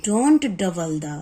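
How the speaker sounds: slow, deliberate reading of a spelling rule; teacher dictating a rule to students, very slow; rule dictation. Shown as a demonstration. Reads English spelling rule slowly.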